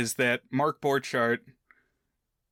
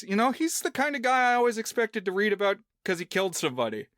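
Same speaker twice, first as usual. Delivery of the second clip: lightly voiced friend, higher and quicker; quick, higher-pitched retold quote; quoting a friend; 7 semitones higher, faster than usual. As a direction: relay a friend's remark in a raised, hurried voice. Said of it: "one of his friends says".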